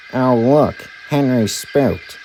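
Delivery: lightly voiced truck, slow mocking; a jeering truck. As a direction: jeer at Henry slowly. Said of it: "said a truck".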